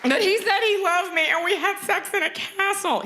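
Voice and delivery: high-pitched whiny Bachelor contestant; hammed-up tearful whine; mock crying. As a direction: wail it like a jilted reality-show contestant. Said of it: "tears that girls on The Bachelor cry".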